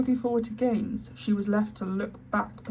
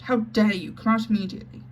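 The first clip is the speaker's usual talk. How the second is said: louder outraged command; angry cry; 2 semitones higher, 5 dB louder than usual. Acted. Headmistress orders the hidden maid out, loud and outraged. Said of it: "she cried out".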